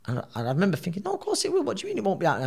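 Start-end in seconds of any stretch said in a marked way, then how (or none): 1.0-2.4 shocked disbelief. Retold or imitated. Boxer thinking back at the doctor who says he can't fight, stunned high disbelief. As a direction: pitch jumps high in stunned protest.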